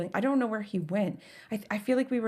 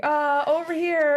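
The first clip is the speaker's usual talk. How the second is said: high, slow, louder call; kid calling out; 8 semitones higher, 5 dB louder, slower than usual. Acted. Wandered-off Cass answering the searchers, high drawn-out call. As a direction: drag it out like a found kid answering.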